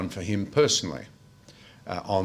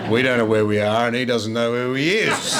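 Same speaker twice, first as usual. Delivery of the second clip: laughing through punchline; 3 dB louder than usual. Real, not acted.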